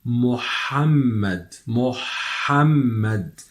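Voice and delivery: MUHAMMAD drawn out slowly, the Arabic ha held clear. Shown as a demonstration. Teacher drills Muhammad for learners, slow with a clear ha. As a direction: stretch MUHAMMAD so the throaty ha is heard. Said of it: "you can hear the pronunciation of the ha clear in".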